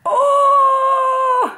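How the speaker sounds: high, loud wow; delighted gasp. Real, not acted. First sight of the color. (oh émerveillé)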